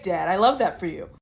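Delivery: usual speaking voice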